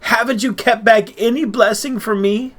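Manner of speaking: high, pleading question; Esau begging. A narrator's reading. Cheated son to his father, begging for any blessing left. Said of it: "He begged".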